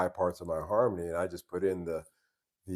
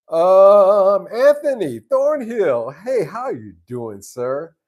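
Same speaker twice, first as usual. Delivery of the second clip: excited greeting; 11 semitones higher, 14 dB louder, slower than usual. Real, not acted. Greeting a viewer on stream.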